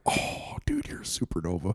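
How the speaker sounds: hushed, voiceless whisper of mock wonder; awed whisper. Friend reacts to a quasar boast, awed whisper. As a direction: whisper it as if awestruck.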